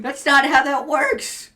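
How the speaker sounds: loud, high, exasperated scolding; laughing-mad tirade; mock outrage. Real, not acted. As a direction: yell in playful indignation at a friend's excuse. Mock outrage at colorblind excuse.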